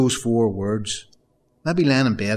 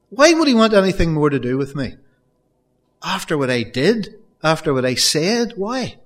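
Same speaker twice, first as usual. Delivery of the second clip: Peter's despair; 5 semitones higher, 4 dB louder than usual. Acted. Preacher voicing Peter's guilt after the denial, rising to anguish. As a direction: let Peter's hopelessness break out louder and higher. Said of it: "he had thought to himself".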